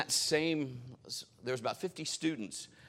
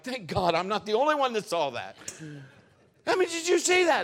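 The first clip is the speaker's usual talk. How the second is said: higher and louder, thrilled; excited exclaim; 10 semitones higher, 9 dB louder than usual. Retold or imitated. Preacher answering the astonished onlookers, thrilled and loud. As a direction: share the amazement eagerly. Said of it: "And I'm like".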